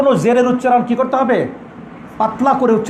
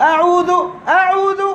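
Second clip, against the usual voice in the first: much higher, straining at the top of the range; pitched too high; 9 semitones higher, much slower than usual. Shown as a demonstration. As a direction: show the wrong way by starting far up high. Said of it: "উপরের দিকে ধরলে উপায় আছে?".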